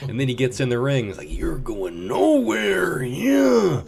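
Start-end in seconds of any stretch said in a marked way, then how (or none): 1.3-3.8 high, slow gloating taunt; wrestler trash talk. Retold or imitated. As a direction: gloat over your prey slowly. Bonesaw taunting in ring.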